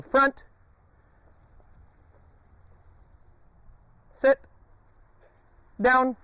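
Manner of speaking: high, loud call to the dog; sharp command. Real, not acted. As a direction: snap the command out.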